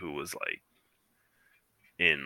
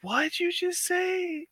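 put-on much higher, slow, astonished old lady; shocked grandma; slower than usual. Grandson imitates grandma shocked at his joke, high and slow. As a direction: gasp it out like a scandalised grandmother. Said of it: "she was just like".

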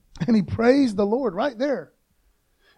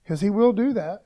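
normal talking voice

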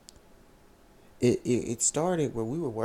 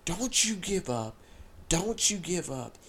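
raised, urgent encouragement, slowing on the first line; retold encouraging yell; 5 semitones higher than usual. Retold to the girl crying at the altar, a raised encouraging yell. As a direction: urge her on not to give up. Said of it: "literally yelling at her like".

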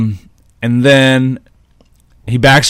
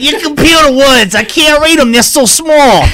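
much higher, louder mock granny complaint; old lady voice; 4 dB louder than usual. Pretend senior squinting at the computer, high granny voice. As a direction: whine like a grandma who can't read the screen.

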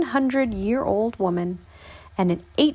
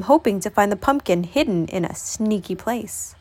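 ordinary delivery, nothing marked

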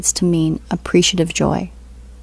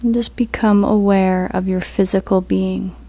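guided meditation; 4 dB quieter than usual. Acted. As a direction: ease them into stillness, unhurried.

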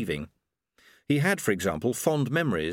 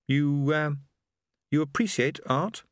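hesitant, halting question; tentative; 5 semitones higher than usual. A narrator's reading. Lobsang nervously asking Susan about art, hesitant. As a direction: feel your way into the question. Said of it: "Lobsang ventured".

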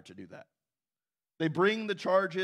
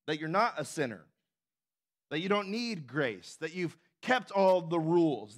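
ordinary talk

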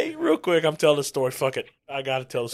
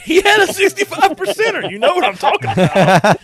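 excited loud; 10 dB louder than usual. Real, not acted. Exaggerated retelling to Shane.

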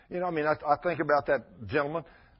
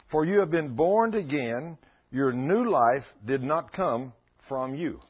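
scripture reading; 3 semitones lower than usual. Acted. Reads scripture aloud.

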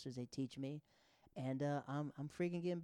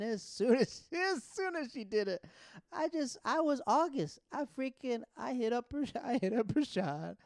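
high, loud, gleeful retelling with laughter; giddy bragging excitement; 10 semitones higher, 6 dB louder than usual. Retold or imitated. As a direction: burst with news you can't hold in, drawn out. Gleefully reenacts rushing to brag.

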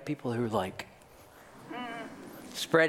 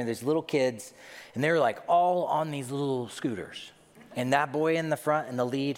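ordinary delivery

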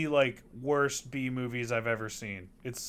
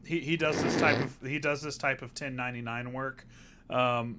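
ordinary talk, nothing marked

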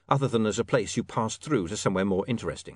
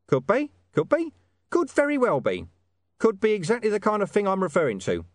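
high, loud haggling informant; name the price and tease the story, pitched well up; 8 semitones higher, 3 dB louder than usual. Acted. Hidden informant selling the dog's story to William, high and insistent. Deep Bone bargains.

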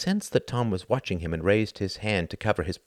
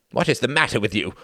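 quick, sharp, louder challenge; fierce demand; 4 semitones higher, 4 dB louder, faster than usual. A narrator's reading. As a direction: fire the question at him fast. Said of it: "he demanded fiercely".

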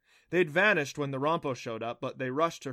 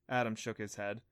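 plain reading voice

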